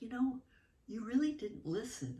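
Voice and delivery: put-on high little-girl voice, earnest; mother imitating her toddler's serious scolding. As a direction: speak as a small child scolding her mother.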